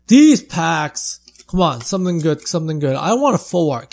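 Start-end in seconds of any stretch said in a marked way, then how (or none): 0.1-1.2 punched, loud frustration; louder complaint. Real, not acted. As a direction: complain with force. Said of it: "Man, these packs".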